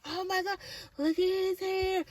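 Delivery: tiny, very high, soft gushing; hushed high-pitched awe; mock amazement. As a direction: squeal it under your breath, high and small. Acting out amazed reaction to hair.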